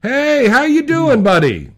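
high, loud, drawn-out hello; big excited greeting. Real, not acted. Greeting caller, big welcome.

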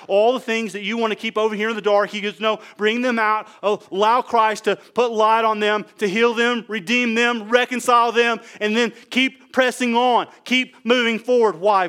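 rally the congregation phrase by phrase; impassioned exhortation. Real, not acted. Preaches exhortation at full force.

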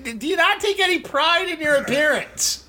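teasing a friend over his holey shirt; mock scolding. Real, not acted. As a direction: chide him with disbelief.

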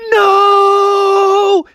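cartoon anguished shout; bears watching the wagon fall; high loud cry of no. Wagon goes over cliff.

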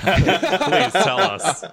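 drawn-out pleading through laughter; laughing plea. Real, not acted. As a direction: beg slowly while laughing.

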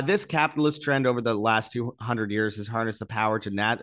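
ordinary talk, nothing marked

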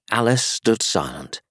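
ordinary delivery, nothing marked

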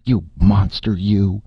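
outraged scolding; indignant. A narrator's reading. Erika insults Martin in anger.

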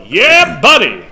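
loud, high whooping catchphrase; yell. Shown as a demonstration. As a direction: holler it out to demonstrate.

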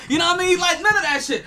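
loud exasperated rant. Real, not acted. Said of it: "fuck all you guys".

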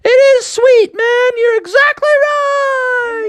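very high shouted glee, stretched at the end; exultant squeal. Acted. Frog sister to shark while surfing, shrieked delight. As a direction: squeal it out, drawing out the last word.

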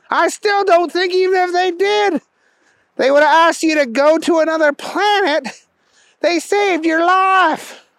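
high-pitched exasperated correction; incredulous buddy. A narrator's reading. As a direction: set your friend straight, high and emphatic. Keith corrects Booger.

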